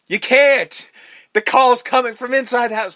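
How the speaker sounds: high, loud frantic warning; mock panicked yell. Retelling the cop warning her on the phone, a high, loud panicked yell. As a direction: yell it like the line just went wrong.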